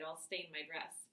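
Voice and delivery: ordinary delivery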